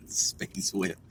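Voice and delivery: low breathy sci-fi hush on "space whip"; whispered space whip. Shown as a demonstration. Narrating his car as a spacecraft, low hushed voice. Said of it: "since I'm flight navigating in the whip".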